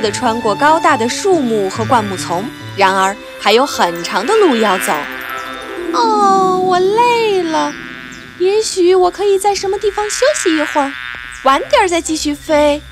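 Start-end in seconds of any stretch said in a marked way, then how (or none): 5.9-12.8 tired little bee. Acted. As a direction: sigh out the tiredness. Tired Maya.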